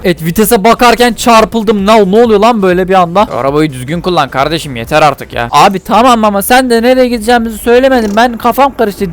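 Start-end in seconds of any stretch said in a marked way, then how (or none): 3.4-5.5 low, stern grumble; fed-up passenger. Acted. Passenger tells crashing driver to drive properly, fed up. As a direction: scold the driver in a much lowered voice.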